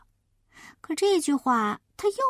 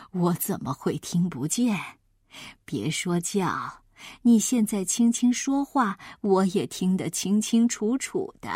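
low granny voice, unbothered; self-assured grandma; 4 semitones lower than usual. Acted. Grandma insists her hearing is fine, low and assured.

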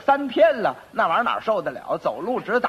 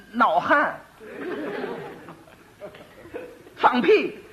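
a raised, angry official throwing him out; angry magistrate; 5 semitones higher, slower than usual. Acted. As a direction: bark him out of the court, pitched high. 知县大怒赶人. (发怒的县官高声呵斥)

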